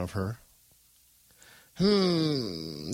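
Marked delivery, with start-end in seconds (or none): 1.8-2.9 lightly voiced creaky old woman; a hint of an old woman's creak. A narrator's reading. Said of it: "the old woman creaked".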